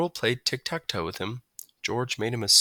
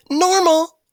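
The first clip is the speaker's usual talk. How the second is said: a loud, high "Normal"; bright announcement; 10 dB louder than usual. A narrator's reading. Margalo reading the thermometer, announcing loud and high. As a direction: announce it cheerfully. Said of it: "she announced".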